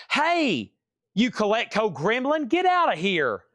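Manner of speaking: high startled yell at an intruder. Pretend. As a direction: shout the gremlin out of the room. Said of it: "you'll be like".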